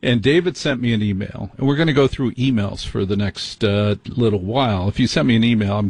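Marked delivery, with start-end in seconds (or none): none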